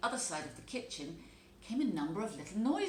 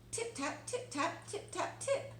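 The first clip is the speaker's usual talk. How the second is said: high, light tip-tap sounds of tiny mice; high tapping noises; 11 semitones higher than usual. A narrator's reading. Mice tapping out from under the teacups, high and light.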